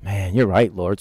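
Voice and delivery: humbled, hushed reply to God; sheepish admission. Retold or imitated. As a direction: concede quietly, caught out. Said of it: "And I was like".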